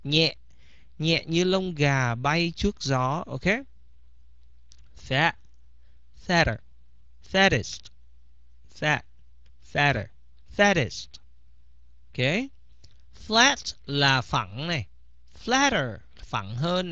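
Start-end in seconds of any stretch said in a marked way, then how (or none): 5.1-11.1 fatter with the T as a quick light flap. Shown as a demonstration. Teacher models the light flap sound for students. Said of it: "very light, very subtle".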